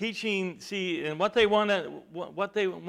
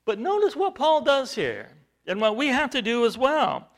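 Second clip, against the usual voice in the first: rising preacher's call, louder and higher; preaching; 7 semitones higher, 8 dB louder than usual. Acted. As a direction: preach it out to the congregation. Preacher building up.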